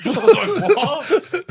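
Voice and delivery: high squeal of disbelief through laughter; shocked laughing what. Retold or imitated.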